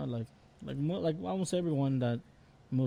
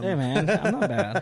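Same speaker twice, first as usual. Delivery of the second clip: laughing protest; 5 semitones higher, 4 dB louder, slower than usual. Real, not acted. Defending his English laughing.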